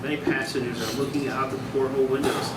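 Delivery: ordinary talk, nothing marked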